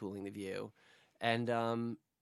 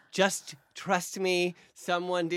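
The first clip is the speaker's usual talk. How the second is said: drawn-out, very slow plea; slow pleading; 4 semitones higher, much slower than usual. Retold or imitated. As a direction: stretch it out, begging. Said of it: "I was like".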